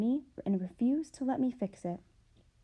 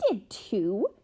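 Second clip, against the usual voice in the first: shriek; 4 dB louder than usual. Acted. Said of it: "shrieked the younger".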